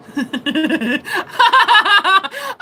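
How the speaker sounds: real high-pitched laughing fit; helpless laughter. Real, not acted. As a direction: crack up and keep going. Cracks up over Helga question.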